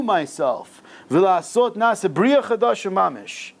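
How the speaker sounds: high, ringing exhortation from a text; chant-like rousing teacher's delivery; fervent preaching. Real, not acted. Reads and expounds text fervently.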